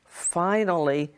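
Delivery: plain reading voice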